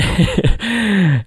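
a short real laugh with a falling tail, up high; a quick laugh at his own joke. Real, not acted.

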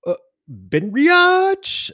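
high, loud, drawn-out misreading; mock exclamation. Brother misreading the shirt aloud, mock exclamation. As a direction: build to a very high, blaring Benriach.

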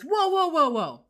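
high, loud run of whoas, dropping at the end; mock-alarmed call to stop; retold alarmed shout. Retelling a character halting his companion, alarmed shout. Acts out character calling a halt.

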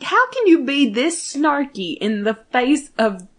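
can't believe what you're hearing; incredulous. Retold or imitated. Fan asks Dean about snark, loud incredulous.

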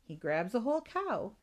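plain reading voice

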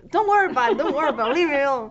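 loud, high, laughing brush-off; loud playful brush-off. Real, not acted. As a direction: wave it off loudly, half laughing. Laughing dismissal of stress.

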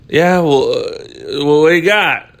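lightly voiced street guy sizing up the offer; mild put-on voice of a homeless man.

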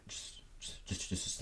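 breathy whisper while miming. Acted. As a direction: hush it, almost silent. Miming a silent throw.

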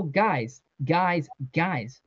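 ordinary delivery, nothing marked

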